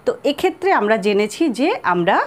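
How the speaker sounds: normal talking voice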